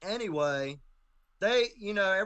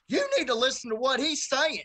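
higher and louder, a sharp command; bailiff's stern order; 9 semitones higher, 7 dB louder than usual. Retold or imitated. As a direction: bark it as the bailiff putting him in his place. Bailiff snaps at him in court.

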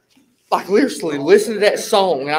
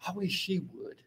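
muttered aside; 18 dB quieter than usual. Retold or imitated. Retold private thought while walking.

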